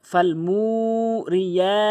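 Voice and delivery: "মু" and "রিয়া" held long and loud; loud elongated model recitation; drawn-out reading. Shown as a demonstration. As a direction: stretch the vowels of "মু" and "রিয়া". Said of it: "এভাবে টেনে পড়তে হবে".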